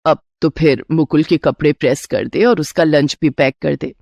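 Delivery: mother-in-law stalling her bahu; instructing mother-in-law. Acted. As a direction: hand out orders in a low voice. Mother-in-law gives chores.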